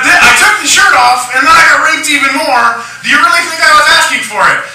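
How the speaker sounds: high, loud, shrill-free hammed-up protest voice; mock outraged protester. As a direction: play it up as an indignant activist. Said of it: "And I said".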